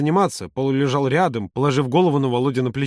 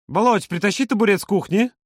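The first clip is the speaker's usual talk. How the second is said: higher-pitched brisk order; commanding; 6 semitones higher than usual. A narrator's reading. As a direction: call out an errand to a helper. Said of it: "скомандовал Юра". (командуя, повышенным тоном)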